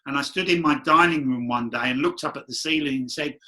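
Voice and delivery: ordinary talk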